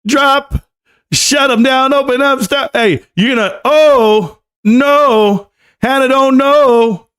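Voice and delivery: high-pitched, exasperated outbursts; excited high reaction. Real, not acted. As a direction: yelp at the clip in disbelief. Reacting loudly to the clip.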